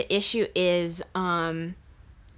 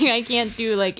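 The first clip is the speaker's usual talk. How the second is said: usual speaking voice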